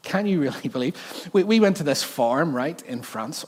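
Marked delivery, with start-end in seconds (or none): none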